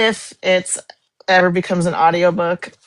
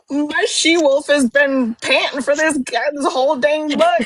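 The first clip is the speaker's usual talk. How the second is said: high, slow, dreamy gush; giddy, swooning quote; 8 semitones higher, slower than usual. Retold or imitated. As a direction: gush like a smitten fangirl. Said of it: "Yeah, I said".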